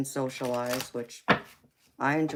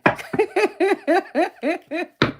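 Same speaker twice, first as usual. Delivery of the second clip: a high, louder burst of giggling; high squealing laugh; 6 dB louder than usual. Real, not acted. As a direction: squeal with laughter at your own joke. Laughing at own gangster boast.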